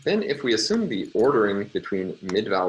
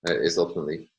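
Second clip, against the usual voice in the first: ordinary delivery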